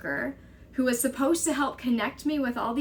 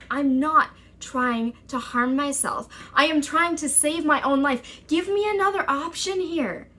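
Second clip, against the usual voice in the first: higher and louder, urgent insistence; desperate protest; 5 semitones higher, 4 dB louder than usual. Retold or imitated. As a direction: insist you're fighting to live. Protesting the committal threat.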